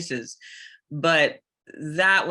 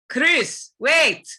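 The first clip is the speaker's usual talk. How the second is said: much higher and louder, a sharp urgent call; heavy African accent; 11 semitones higher, 6 dB louder than usual. Shown as a demonstration. Sheva calling to partner Chris in a heavy accent. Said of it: "It's very low, this register".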